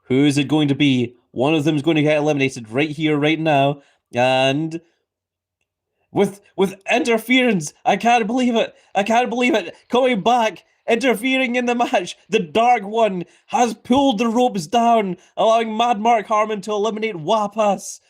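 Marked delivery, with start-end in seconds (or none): 6.1-18.0 excited commentary. Real, not acted. Surprise interference in the match.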